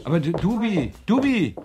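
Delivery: rising high call of the name; calling after. Acted. Student calling after his friend Dubi. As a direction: call her back as she turns away.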